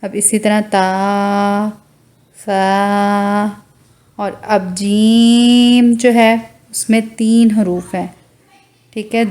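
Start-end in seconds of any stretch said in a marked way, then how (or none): none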